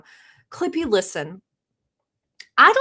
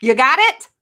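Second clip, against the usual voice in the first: loud, higher-pitched check; scolding yell; 4 semitones higher, 9 dB louder than usual. Acted. Streamer laying down rules to the chat, scolding yell. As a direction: yell it like a telling-off. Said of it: "Don't make me yell like this".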